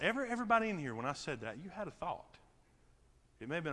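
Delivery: normal talking voice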